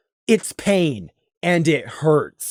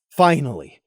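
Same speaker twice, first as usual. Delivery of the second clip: usual speaking voice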